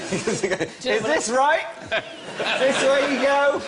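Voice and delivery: high put-on voice, rising, unsure; mock nervous passenger. Comedy sketch passenger asking about seats, high and unsure. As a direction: play a jittery first-time flyer.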